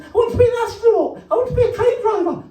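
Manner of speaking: put-on high, fast childish squeal; kids' voices. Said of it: "And they said".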